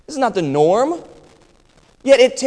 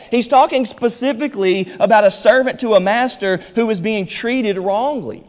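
ordinary talk

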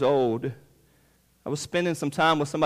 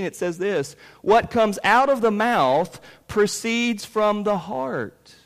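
high, loud, emphatic sermon delivery; full-force preaching; 8 semitones higher, 6 dB louder than usual. Real, not acted. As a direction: press the point hard from the pulpit, pitched high.

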